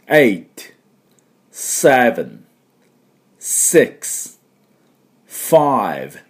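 slow countdown, one spaced word at a time; each number drawn out and falling, with long gaps. Shown as a demonstration. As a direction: count down deliberately for learners to join in. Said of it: "カウントダウンです。".

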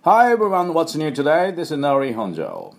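high, loud greeting, descending into the name; upbeat show opening. Real, not acted. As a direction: hail the listeners with big energy. Energetic podcast opening greeting. (元気いっぱいの番組冒頭の挨拶)

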